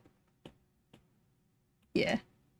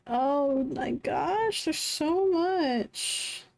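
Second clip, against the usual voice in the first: amazed; 7 semitones higher, much slower than usual. Real, not acted. Amazed at the amount.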